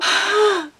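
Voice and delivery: high, theatrical gasp; horrified gasp. Acted. Reacting to the packed second mall, hammed-up gasp. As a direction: gasp in mock horror. Mock collapse over mall parking.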